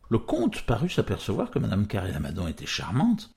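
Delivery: plain reading voice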